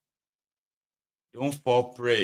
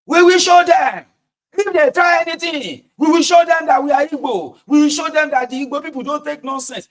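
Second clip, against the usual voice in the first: high, loud rallying shout; agitator's rant; 12 semitones higher, 11 dB louder than usual. Acted. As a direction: shout it out like a rabble-rouser, much higher and louder. Acting out chanting agitators.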